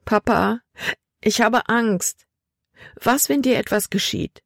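high, frightened daughter's voice; tearful fear. A narrator's reading. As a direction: plead on the edge of tears. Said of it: "Tränen stiegen Libby in die Augen". (ängstlich, den Tränen nah)

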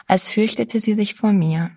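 plain reading voice

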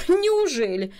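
high-pitched astonished question; astonishment, pitch jumping up; amazed exclamation. A narrator's reading. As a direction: exclaim it in sheer disbelief. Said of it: "в изумлении воскликнула бабушка".

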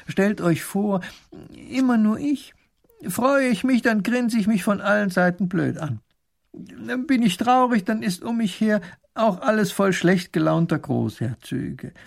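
high, plaintive little ruler voice; little grand duke lamenting. Acted. Grand duke lamenting to his court about seeing only himself, plaintive. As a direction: complain in a high, worked-up voice.

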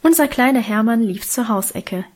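ordinary delivery

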